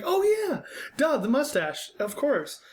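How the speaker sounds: delighted fan recognizing him; high-pitched fan. Retold or imitated. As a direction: squeak it out like an excited kid fan. Fan voiced in pool story.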